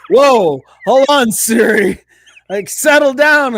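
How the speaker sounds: loud protest at a cheeky Siri; acted, startled telling-off; mock alarm. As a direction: rein in an unruly voice assistant, loudly. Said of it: "my Siri to the, the Southern accent".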